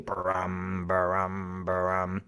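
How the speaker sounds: rolled, humming drum imitation; drum sound. A narrator's reading. Mouse plays Cat's drum, steady humming drumbeats. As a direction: voice a steady resonant brum.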